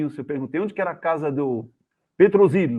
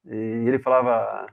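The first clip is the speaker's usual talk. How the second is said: ordinary delivery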